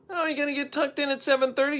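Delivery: high, quick sing-song taunt; teasing mock. Mocking the early-to-bed town in a high, quick taunt. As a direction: needle them like a cheeky kid.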